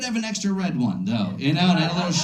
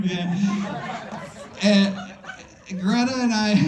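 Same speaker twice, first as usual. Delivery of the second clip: ordinary delivery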